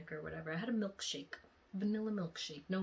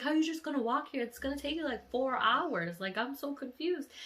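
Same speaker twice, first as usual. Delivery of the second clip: higher, fast, baffled questioning; incredulous disbelief; 8 semitones higher, 4 dB louder than usual. Retold or imitated. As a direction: disbelief that he'd walk there. Shocked he'd walk four hours.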